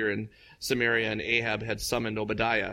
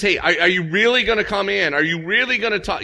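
nervous Obadiah; 9 semitones higher, 8 dB louder than usual. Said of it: "he's nervous about this".

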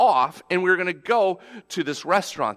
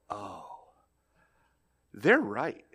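soft realization; 8 semitones lower, 8 dB quieter, slower than usual. Retold or imitated. Sudden realization watching the kids.

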